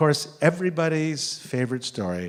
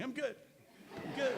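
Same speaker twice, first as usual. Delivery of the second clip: much higher, small squeaky voice; squeaky reassurance; 14 semitones higher, 12 dB quieter than usual. Retold or imitated. The fallen boy telling the audience he is fine, squeaky and high. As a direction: brush it off like a chipper kid. Kid insisting after falling.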